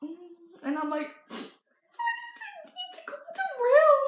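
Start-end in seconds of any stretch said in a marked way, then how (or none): 1.3-4.0 very high, slow squeaky sob; mock bawling. Explaining the trailer to grandma, hammed-up high crying squeal. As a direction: choke it out in an exaggerated squeal of tears. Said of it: "I started bawling, crying".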